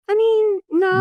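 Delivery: hammed-up reluctant disagreement; doubtful correction. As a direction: push back in a high, doubtful voice. Acts out doubtful reply to outsiders.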